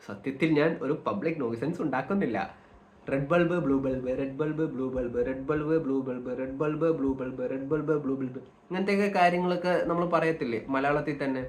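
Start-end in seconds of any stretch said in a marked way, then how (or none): 3.1-8.4 rapid monotone repetition; even, looping tongue-twister drill. Shown as a demonstration. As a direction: rattle it off rhythmically without a break. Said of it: "tongue twisters".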